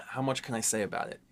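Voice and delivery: ordinary talk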